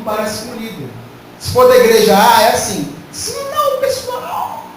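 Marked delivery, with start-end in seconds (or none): 3.1-4.7 very high put-on preacher voice; mock church A leader, squeaky high; imitating a preacher. Pastor imitating a church leader, high mock voice. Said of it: "a voz, a entonação".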